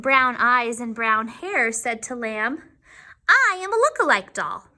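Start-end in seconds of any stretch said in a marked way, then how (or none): 3.3-4.6 high, self-important doll voice. Acted. Doll introducing herself to Lamb, self-important. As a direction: announce yourself like a proud big sister. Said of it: "She sounded important".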